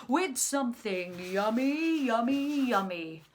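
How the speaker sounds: put-on rising call for children to copy; biggest, loudest voice. Shown as a demonstration. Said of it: "loudest voices".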